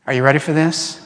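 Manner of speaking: ordinary talk, nothing marked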